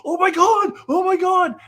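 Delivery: high, loud starstruck squeal; hammed-up awed fans; mock gasping fans. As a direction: squeal like an amazed audience. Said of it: "And people go".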